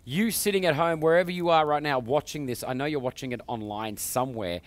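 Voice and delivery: loud, high, upbeat show host announcing callers; TV host hype. Acted. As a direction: pump up the audience for the call-in. Host hypes the call-in segment.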